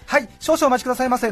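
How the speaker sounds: high, polite waiter voice; sing-song server politeness; customer service voice. Retold or imitated. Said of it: "なんて言って".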